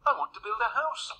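high, quick piggy voice; polite little pig. A narrator's reading. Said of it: "he asked politely".

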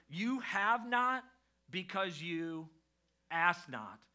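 very slow, each phrase set apart, HAVE NOT loud; emphatic scripture quote. Acted. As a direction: hammer out "You have not", pause, then "ask not". Quotes a haunting verse for emphasis.

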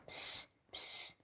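hushed, hissed attention-getter; sneaky whisper. Acted. Buster calling Tom before telling nicknames, sneaky whisper. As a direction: whisper to a classmate on the sly.

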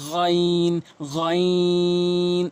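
"গইন" gargled from the back throat, held long; drawn-out throaty letter. Shown as a demonstration. Teacher demonstrating, long throaty "গইন". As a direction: stretch "গইন" slowly. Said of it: "টেনে বলবেন এবং হলকের শেষ থেকে".

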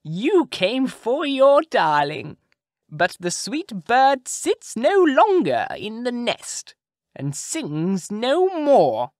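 high, loud, slow taunting witch; mocking witch. A narrator's reading. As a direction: savour every cruel word to the prince. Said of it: "mocking him".

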